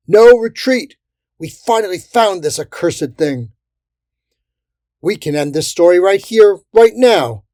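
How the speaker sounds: high, loud rallying order; defiant shout. A narrator's reading. Sergeant refusing to fall back, defiant shout. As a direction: refuse retreat at full voice.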